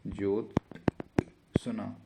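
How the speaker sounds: Jyotsna split into slow, separate parts; very slow sounding-out. Shown as a demonstration. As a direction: break Jyotsna after ज्योत, then सना. Teacher sounds out the word. (Jyotsna को बहुत धीरे तोड़कर)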